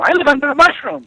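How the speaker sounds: high, sing-song mock innocent voice; mock naive voice. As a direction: play the sweet naive type who lives under a mushroom. Mock quote of a naive person.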